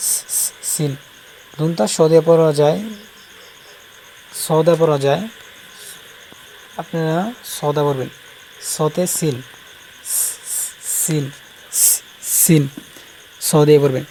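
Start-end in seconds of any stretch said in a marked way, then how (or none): 0.1-0.9 voiceless hissed সি leading into সিন; soft sibilant hiss on সি, সি, সিন. Shown as a demonstration. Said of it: "সি শব্দ করে সিন শ". 10.1-12.6 bare "sss" for সি, then সিন; long sibilant s starting সিন; hissed S. Shown as a demonstration. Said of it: "সি শব্দ করে সিন শ".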